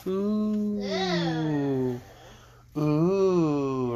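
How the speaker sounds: put-on sing-song teasing coo like a child; teasing ooh. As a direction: tease the couple about holding hands. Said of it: "says my middle munchkin".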